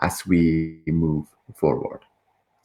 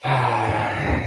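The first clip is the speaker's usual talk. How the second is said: long, audible breath out; long sigh; 6 semitones higher than usual. Shown as a demonstration. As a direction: let out a long, high sigh. Opening the breathing exercise.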